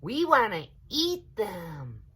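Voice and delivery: eager little voices; eager children. A narrator's reading. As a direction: kids begging for a treat. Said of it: "they said".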